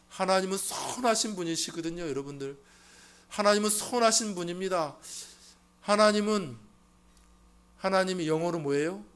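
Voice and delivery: loud, high proclamation; full-force preaching. Acted. Pastor proclaiming God's gifts to the congregation, loud. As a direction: preach it out to the back row.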